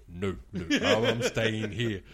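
slow, drawn-out refusal through a chuckle; amused stubborn refusal. Retold or imitated. Dealer refusing his supervisor's offer to move, amused stubbornness. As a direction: dig your heels in, stretching the words.

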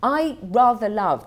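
normal talking voice

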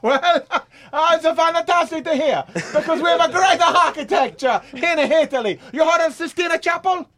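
high, loud, laughing with joy; overjoyed. Acted. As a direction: burst with giddy Italian delight.

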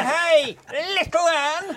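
high, stretched-out comic greeting; high put-on voice. Comedian showing off his voices to the panel, high put-on voice. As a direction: burst into a high character voice.

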